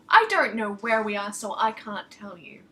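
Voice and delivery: ordinary delivery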